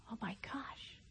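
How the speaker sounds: barely voiced gasp of words; stunned whisper. Real, not acted. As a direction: breathe it out stunned. Overwhelmed memory.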